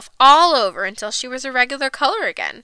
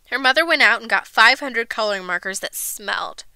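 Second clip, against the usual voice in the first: usual speaking voice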